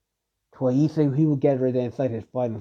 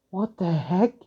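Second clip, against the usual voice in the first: shocked; 5 semitones higher, slower than usual. Acted. Rin sees weapon crushed.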